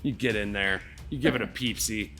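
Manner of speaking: ordinary delivery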